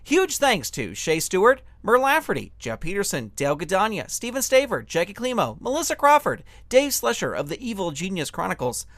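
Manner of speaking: ordinary delivery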